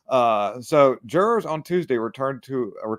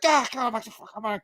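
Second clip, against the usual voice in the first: mock-flustered stammer, pitched way up; flustered Mike; 9 semitones higher than usual. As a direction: sputter like a caught-out politician. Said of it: "You're like".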